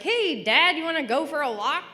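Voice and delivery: high, bright-eyed invitation; high-pitched, loud hail then quick question; over-cheery daughter. Retold or imitated. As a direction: coax dad with exaggerated pep. Chirpy invitation voiced to dad.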